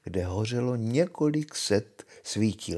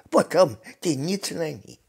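high witch's snappy retort; snapped retort; 6 semitones higher, 5 dB louder than usual. A narrator's reading. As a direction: brush the question off sharply, pitched up. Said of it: "odsekla čarodějnice". (čarodějnice úsečně odsekne)

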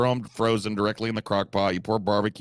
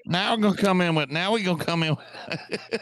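mock Southern accent; 8 semitones higher than usual. Mocking the video cook's Southern accent for the stream. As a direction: parody a TikTok cook's fake Southern voice. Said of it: "someone putting on that accent".

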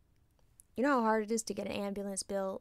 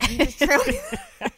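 louder, pushed-up insistence; emphatic insistence; 13 semitones higher, 7 dB louder than usual. Real, not acted. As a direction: insist on it firmly. Insisting on plan.

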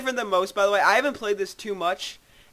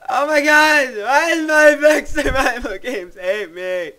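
exasperated; 7 semitones higher, 11 dB louder than usual. Real, not acted. Dies in game.

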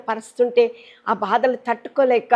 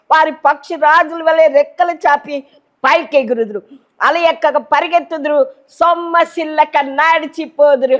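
raised, ringing scripture proclamation; fervent preacher's declamation; preaching at full force; 4 semitones higher, 4 dB louder than usual. Real, not acted.

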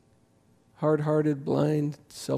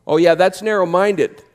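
sarcastic mock; 4 semitones higher, 9 dB louder than usual. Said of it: "that's what we do".

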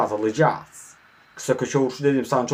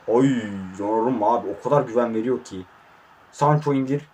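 ordinary talk, nothing marked

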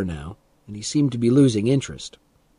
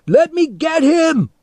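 high, loud, drawn-out yell; furious shout; 7 dB louder than usual. A narrator's reading. Teddy held back from the man, shouting. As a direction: yell it straining to attack. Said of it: "Teddy shouted".

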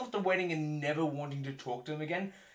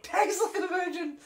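much higher mock voice, laughing; old father-in-law; 4 dB louder than usual. Father-in-law's verdict, laughing in mock voice. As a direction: play the old man while cracking up. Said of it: "Just imagining an old guy".

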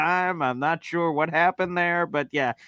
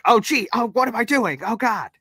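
high, flustered panicky voice; flustered son; 9 semitones higher than usual. Retold or imitated. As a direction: squirm like a caught teenager. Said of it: "And I'm like".

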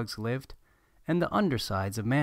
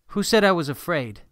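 put-on defiant kid, sharp and loud; shot back; 6 semitones higher, 6 dB louder than usual. A narrator's reading. Boy snapping back at his dad, defiant and loud. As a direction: snap it back defensively. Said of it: "Nicholas shot back".

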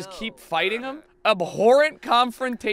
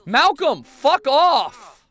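loud, raised, irritated dismissal; annoyed shout; 6 semitones higher, 8 dB louder, much slower than usual. Pretend. Player shooing Malcolm in the game, annoyed shout. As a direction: yell to drive someone off. Chasing off an intruding character.

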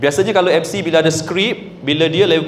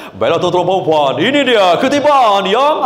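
over-the-top announcer intonation; 2 semitones higher than usual. Shown as a demonstration. MC wrongly hyping a Quran recital event, over-the-top. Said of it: "Jangan guna intonasi yang pelik-pelik sangat".

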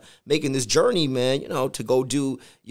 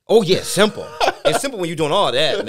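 laughing; 5 semitones higher, 7 dB louder than usual. Real, not acted. Laughing at easy school theology.

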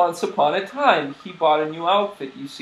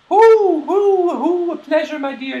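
very high, loud owl hoots; high hooting old-lady farewell; 11 semitones higher, 6 dB louder than usual. Acted. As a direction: trill a cheery farewell as an owl granny.

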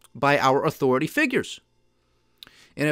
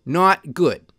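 normal talking voice

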